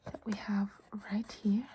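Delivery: normal talking voice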